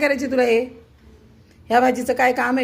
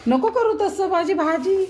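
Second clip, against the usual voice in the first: higher, slow pleading scold; scolding the cat; 8 semitones higher, slower than usual. Real, not acted. Telling the cat to leave her vegetables alone, slow high scold. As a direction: drag it out to stop the cat.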